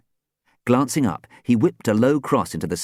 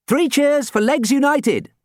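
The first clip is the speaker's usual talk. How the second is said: high, loud rallying call; calling cheers; 6 dB louder than usual. Acted. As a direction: lead the team's cheer. Skipper calls for cheers.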